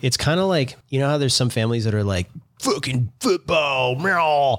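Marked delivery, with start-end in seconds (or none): 2.6-4.6 slow, drawn-out dumb jock voice; mock sports fan. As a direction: drag it out like a meathead fan. Mocking sports-obsessed families.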